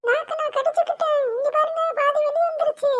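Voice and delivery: put-on high, loud child's voice in alarm; high, frightened child squealing over her tongue; panicked little girl. Child voice held through the tongue prank.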